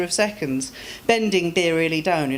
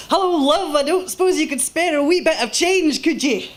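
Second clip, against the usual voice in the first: cheery Scottish rough sleeper, higher and louder, calling out; Scottish street beggar; 11 semitones higher, 5 dB louder than usual. Acted. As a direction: hail a passer-by like a friendly Glaswegian beggar.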